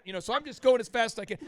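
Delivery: ordinary talk, nothing marked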